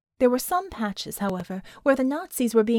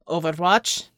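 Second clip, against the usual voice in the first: low, firm commanding call; 5 semitones lower, 4 dB louder than usual. A narrator's reading. Commander calls overwatch on her visor, low commanding voice. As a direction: take charge with one lowered word. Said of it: "she said in a commanding voice".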